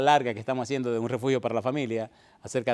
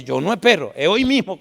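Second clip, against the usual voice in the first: firm declaration; 5 semitones higher, 9 dB louder than usual. Retold or imitated. Daughter insists on baptism now.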